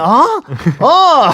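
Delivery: high-pitched mock bafflement; hammed-up confused squeal. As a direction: imitate baffled, then dawning realisation. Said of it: "She would have been all confused".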